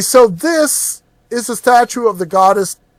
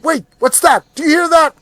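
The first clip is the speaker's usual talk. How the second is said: high, startled whisper-like alarm; suddenly hearing danger; 10 semitones higher than usual. Acted. Alarmed adventurer hears something.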